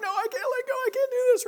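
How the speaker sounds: put-on high, frightened child's wail; a child frozen on the jungle gym, panicking; terrified kid. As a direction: cry it out like a scared little kid. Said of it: "this little kid's, like, terrified".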